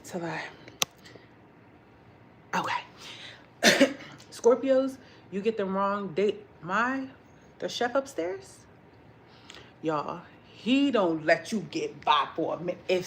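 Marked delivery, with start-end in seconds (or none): none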